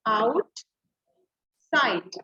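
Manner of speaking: "आउट", long pause, "side"; split syllables. Shown as a demonstration. Teacher leading children to repeat along, आउट - side split apart. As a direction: sound out आउट and side as two separate beats. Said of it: "इनको बोल के देखो मेरे साथ".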